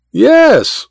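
high-pitched mocking echo; mock the other man's disbelief; mimicking tone. A narrator's reading. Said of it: "mimicking Goodall's tone".

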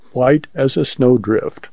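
plain reading voice

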